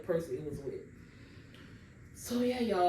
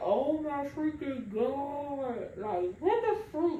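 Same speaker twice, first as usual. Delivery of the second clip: slow, higher, drawn-out mock gasp of surprise; fake shock; 7 semitones higher, much slower than usual. Pretending to be shocked for the family, slow hammed-up disbelief. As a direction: ham up being shocked. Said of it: "I had to put on the act".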